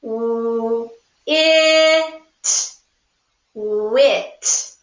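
"W-, it, wit" split into separate sounds, then blended; sounds chopped apart. Shown as a demonstration. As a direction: chop "wit" into its sounds slowly. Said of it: "Chop the sounds in wit".

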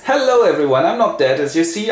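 high, breezy announcement; cheery Hamlet. Acted. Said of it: "And Hamlet steps in".

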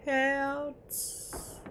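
slow, hushed mock church prayer. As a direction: pray it like a mock sermon in a church. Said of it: "ich mach kurz ein, ein Gebet". (langsames, gespieltes Gebet in der Kirche)

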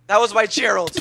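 high, loud mock lament; overacted grief. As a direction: wail like a tragic hero. Pet lost, mock grief.

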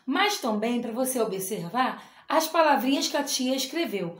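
ordinary delivery

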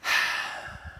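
long breathy sigh. Real, not acted. Reader pausing between stories, sighing. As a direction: let out an audible breath.

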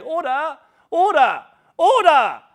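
loud high cry of order; imitating the Speaker. Preacher mimics Speaker calling the house to order. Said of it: "he spends the whole time saying".

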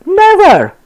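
high, loud outraged cry; cried out. Acted. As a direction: cry out in disbelief. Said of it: "cried Mickey".

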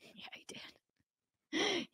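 breathy laugh through the words; laughing whisper. Real, not acted. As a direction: giggle it out under breath.

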